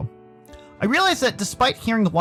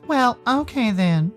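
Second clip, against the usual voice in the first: slow, higher, faintly sad reply; tinge of sadness; 7 semitones higher, much slower than usual. Acted. Sal letting Dave leave, with a tinge of sadness. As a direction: let a little disappointment show, slowly. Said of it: "a tinge of sadness in his voice".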